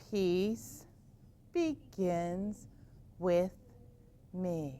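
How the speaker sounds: slow mantra. Shown as a demonstration. Teacher guiding kids through the mantra slowly and softly. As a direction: breathe each word out slowly. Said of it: "Can you say it with me".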